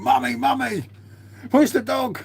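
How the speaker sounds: put-on high, childish whine of mummy, mummy; mocking a drunk man calling his mum. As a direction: mimic a grown man crying for his mum, high and whiny. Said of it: "when he's running around with the phone".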